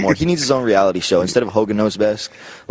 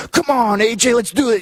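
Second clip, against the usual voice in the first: much higher, pumped-up hype voice; rally him like a cheerleader; Scotty cheering; 13 semitones higher than usual. Retold or imitated. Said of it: "he's like".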